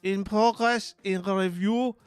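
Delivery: high, slow, hammed-up German accent; officious German reporting on a task board; hard German accent. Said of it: "Harder on the vocalizations into a more monotone".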